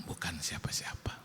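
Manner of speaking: soft secretive whisper. Retold or imitated. Telling his wife his private vote, whispered. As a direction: whisper it so nobody overhears.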